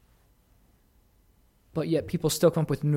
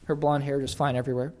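ordinary talk

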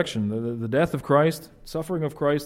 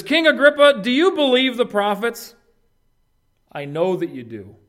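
bold invitation; 8 semitones higher, 5 dB louder than usual. Retold or imitated. Paul's invitation to the king.

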